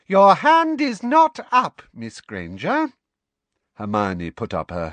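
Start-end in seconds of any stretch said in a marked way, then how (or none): 0.1-2.9 high, loud, slow sing-song rebuke; trilling professor. A narrator's reading. Professor cutting off a student who spoke unbidden, trilling. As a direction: trill it out, drawing each word long.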